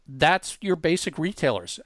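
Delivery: ordinary talk, nothing marked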